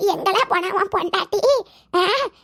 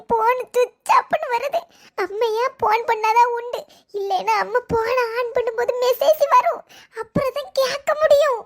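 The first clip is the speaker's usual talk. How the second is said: usual speaking voice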